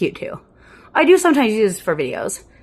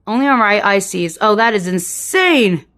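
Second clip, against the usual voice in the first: louder, slower amazed exclaim; 7 dB louder than usual. Real, not acted. Vlogger amazed by her eye in the mirror, exclaiming louder. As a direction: marvel out loud at her own eye.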